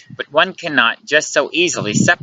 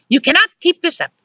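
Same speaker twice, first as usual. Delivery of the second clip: high, urgent female voice; exasperated scolding. A narrator's reading. Luciana demands they find a solution, exasperated scolding. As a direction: rattle off complaints, rising to the last question. Luciana confronts him in bedroom.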